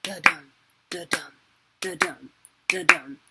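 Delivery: da-dum, da-dum with the dum hit hard, like a heartbeat; heartbeat rhythm. Shown as a demonstration. Teacher demonstrating iambic heartbeat, stressed second beat. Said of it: "you would think heartbeat".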